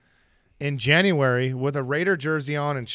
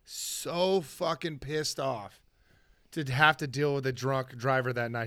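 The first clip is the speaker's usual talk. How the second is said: ordinary talk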